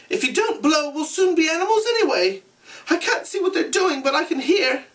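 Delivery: put-on high anxious boy. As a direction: urge him on, worried. Piggy urges Ralph.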